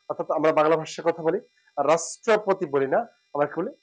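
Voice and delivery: ordinary delivery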